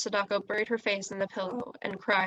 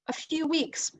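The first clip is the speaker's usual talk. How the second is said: high, stunned "A few weeks!"; dismayed exclamation; 7 semitones higher, slower than usual. Acted. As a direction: cry it out in disbelief. Sadako's dismay at news.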